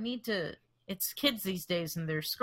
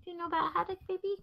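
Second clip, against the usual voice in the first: high sing-song talk to a cat; high, babying pet voice; 10 semitones higher, much faster than usual. Pretend. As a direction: coo a question to the pet. Talking baby talk to cat.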